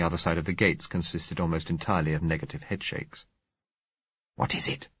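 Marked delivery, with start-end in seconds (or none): 4.4-4.9 soft unvoiced whisper. A narrator's reading. Gisela whispering a question to the narrator. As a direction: breathe the question, barely audible. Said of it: "Gisela asked in a whisper".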